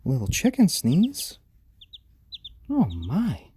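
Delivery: high, loud squeal of delight; 1 delighted gasp. Real, not acted. Owner delighted as her chick sneezes, high squeal. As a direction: gasp with joy at something tiny.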